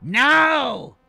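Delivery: high, loud, drawn-out yell; shouted command. Acted. Ichar overrules a crewman freeing his weapon, shouted command. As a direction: cut off all argument with a yell.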